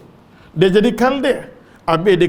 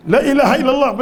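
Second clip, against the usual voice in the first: higher, louder proclamation of faith; raised declaration; 8 semitones higher, 5 dB louder, faster than usual. Shown as a demonstration. Preacher proclaiming the shahada to the crowd, raised. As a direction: lift it up as a cry of faith. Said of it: "repeats the phrase".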